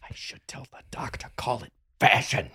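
conspiratorial whisper; whispered aside. Real, not acted. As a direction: whisper it behind your hand.

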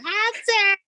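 high, sweet mock hello; a lightly voiced sweet greeting. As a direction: chirp the name up high like a sweet caller. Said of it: "She said".

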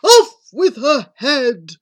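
slow, very loud royal roar; roared command. Acted. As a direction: thunder out the order word by word. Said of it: "the prince roared back".

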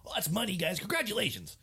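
high-pitched mock celebrity congratulations; hammed-up TV host voice, way up high; Guy Fieri. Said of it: "Man, he always talks like that".

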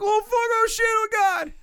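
high, loud mock fright; mock panic. Imitating relatives reacting to a thrown fuse, high mock panic. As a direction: shriek in fake terror. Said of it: "everybody would be like".

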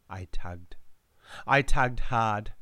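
ordinary delivery

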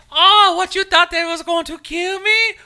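shrill-pitched parody of a smug woman; mocking girl voice. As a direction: ham up a smug survivor.

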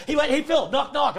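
high, loud, eager setup; gleeful joke pitch. Retold or imitated. Friend feeding him knock-knock jokes, gleeful and loud. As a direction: pitch the gag with excited glee.

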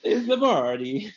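high, drawn-out put-on Italian accent; mock Italian accent. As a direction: stretch it out in a hammed-up Italian accent. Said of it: "said that in an Italian accent".

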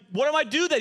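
high, loud comic desperation; panicked mock question. Preacher replays asking friend for a remedy, loudly panicked. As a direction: demand help in exaggerated panic. Acted panic over burning mouth.

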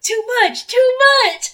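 high-pitched "too much", mock disgust; high disgusted outcry. Real, not acted. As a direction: squeal it in disgust.

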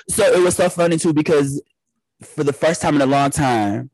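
ordinary talk

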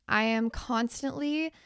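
ordinary talk

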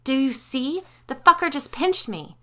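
high, loud pained fury; cursing at the pinch; wife shouting after being pinched, angry; angry outburst. Acted. Sally just got pinched.

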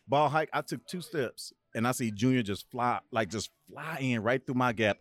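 ordinary talk

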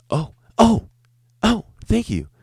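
very high, squeaky stammered thanks; mock customer moan. Customer thanks waiter for refill, hammed-up high squeak. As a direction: ham up the customer's breathless gratitude. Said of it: "That's what he says".

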